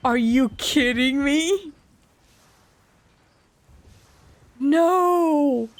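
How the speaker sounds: high, slow, appalled refusal; horrified protest. Real, not acted. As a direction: cry out against it in horror. Refusing to sacrifice a kid.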